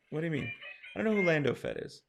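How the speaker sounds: ordinary talk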